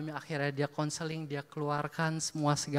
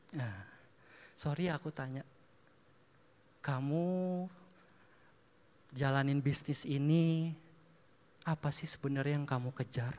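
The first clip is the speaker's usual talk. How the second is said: gentle probing question; 5 semitones lower, 6 dB quieter than usual. Retold or imitated. Gently probing a stranger's heart.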